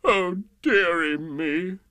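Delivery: slow, loud, wailing lament; hammed-up tearful king; mock sobbing. A narrator's reading. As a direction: draw it out as a self-pitying sob. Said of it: "The King sobbed".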